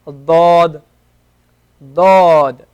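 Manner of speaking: loud, heavy "Dod" with the tongue sides pressed up; the Arabic letter dod, said loudly; emphatic Arabic dod. Shown as a demonstration. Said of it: "So this is the letter".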